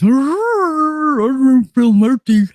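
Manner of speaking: mocking the governor. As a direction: drag it out like a pompous speech.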